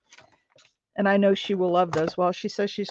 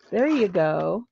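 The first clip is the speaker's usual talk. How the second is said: ordinary delivery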